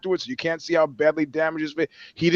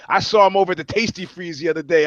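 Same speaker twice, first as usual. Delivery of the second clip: normal talking voice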